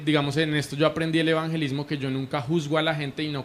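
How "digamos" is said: ordinary talk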